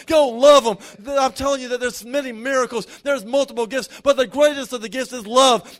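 loud, rising preacher's cadence, driving each line home; preaching at full force. Acted. Pastor urging the standing church to receive, preached full force. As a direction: pour it out over the congregation like a revival call.

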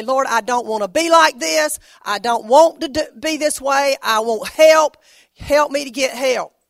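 loud, desperate plea for help; crying out to God. Pretend. Preacher voicing a sinner crying out to God, loud and desperate. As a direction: shout it out like a sinner begging God.

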